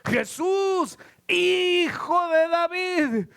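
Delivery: high, slow, sustained pleading call; crying out. Acted. Blind Bartimeo begs Jesús for mercy, crying out. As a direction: draw out every phrase in a high cry for mercy. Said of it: "Y entonces gritaba y gritaba".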